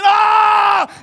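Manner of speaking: full-voiced scream of panic; loud scream. Preacher acting the disciples in the storm, screaming. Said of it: "some are screaming".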